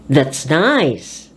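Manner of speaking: "nice" lifted well above "That's"; stress on NICE. Shown as a demonstration. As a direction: put force into "nice". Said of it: "put force into adjectives".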